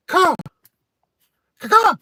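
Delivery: very high shrieking caw; loud crow caw. Retold or imitated. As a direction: screech the caws out loud. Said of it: "or I'd just".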